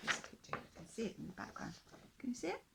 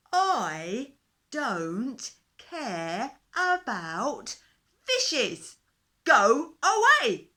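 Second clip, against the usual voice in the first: very loud, very slow bellow, rising higher; roaring dinosaur; 7 semitones higher, 20 dB louder, much slower than usual. A narrator's reading. Dinosaur bellows at the fish king to go away. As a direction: blast him away with a huge roar.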